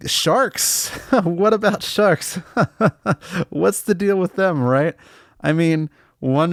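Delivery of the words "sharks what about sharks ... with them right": higher, laughing through a floundering bit; flailing stand-up opener, cracking up; nervous laughing. Acted. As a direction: stall with a high, nervous giggle. Flustered comedian forced to freestyle.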